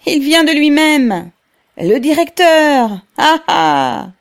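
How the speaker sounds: high, loud calls; exclamations from crowd. A narrator's reading. Workers spot the director coming, exclaiming high and loud. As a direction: cry out the news across the crowd. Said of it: "quelques faibles exclamations retentirent".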